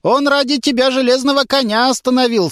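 high admiring auntie voice; a gushing older woman; lightly voiced auntie. A narrator's reading. (тётушка восхищённо, высоким голосом)

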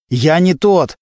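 high, desperate cry to be believed; acted anguished plea; agitated pleading. A narrator's reading. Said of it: "не успокаивался Глущенко".

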